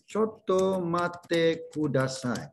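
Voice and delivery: choto mate kudasai said very slowly, each syllable clear; slow teaching demo of choto mate kudasai. Shown as a demonstration. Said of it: "Saya tuliskan sini ya".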